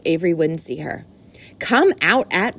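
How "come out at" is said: slow, emphatic command; mildly raised, deliberate scolding; cried order. A narrator's reading. Mother calls her son out, firmly crying out. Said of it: "cried Missus Arabelle".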